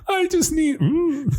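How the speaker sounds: squeaky voice cracking high; cracking teen voice. Retold or imitated. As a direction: imitate a boy's voice breaking. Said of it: "hear his young thirteen-year-old voice cracking".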